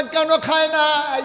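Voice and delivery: high, slow intoned question; concerned questioning. Acted. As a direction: draw the question out loudly. Omar questions his weeping wife. (চড়া সুরে টেনে প্রশ্ন)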